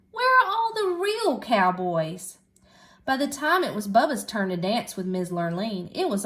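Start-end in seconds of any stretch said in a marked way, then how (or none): 0.1-2.4 complaining. A narrator's reading. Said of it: "she complained".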